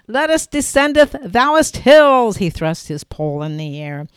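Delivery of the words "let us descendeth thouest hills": loud, high hammed-up old English knight; hammed-up courtly knight. Man proclaims to his girlfriend on the slope, hammed-up knight voice.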